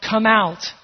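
restrained imitation of a shouted command; firm retold summons; mock shout. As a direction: call it out firmly, short of a full shout. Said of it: "he's shouting".

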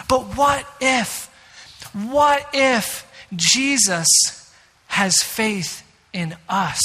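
drawn out, very slow, weighty; slow rhetorical build to the key idea; slow dramatic question. Real, not acted. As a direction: let each word hang. Dramatic sermon point, slowed.

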